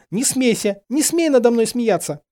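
high, loud cry, close to weeping; near tears. A narrator's reading. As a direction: cry out as if tears are about to come. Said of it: "чуть не плача выкрикнула Гермиона".

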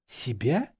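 «Себя» swooping up at the end; puzzled echo question. A narrator's reading. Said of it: "Что-то в голосе Гарри". (удивлённый переспрос «Себя» с подъёмом в конце)

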